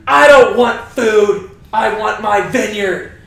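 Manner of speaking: put-on slow, loud childish refusal; whine-shout it like a pouting king; drawn-out petulant protest; sulky tantrum. Sulking king acted as tantrum.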